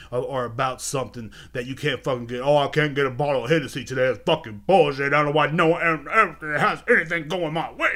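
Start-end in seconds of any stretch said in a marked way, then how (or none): 2.4-7.9 loud mock whine building to the end; mock whining. Mocking people who whine over missing Hennessy, loud put-on whining. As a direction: imitate a spoiled complainer's whine, hammed up. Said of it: "sitting there whining all fucking day".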